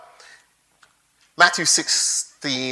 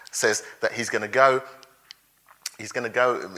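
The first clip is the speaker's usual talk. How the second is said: ordinary delivery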